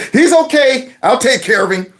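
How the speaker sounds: high, put-on cheerful cover-up; smooth it over for the onlookers; fake-smile reassurance. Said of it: "the fake smile".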